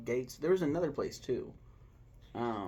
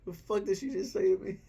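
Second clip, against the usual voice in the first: laughing through it; 9 semitones higher, 5 dB louder than usual. Retold or imitated. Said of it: "He was like".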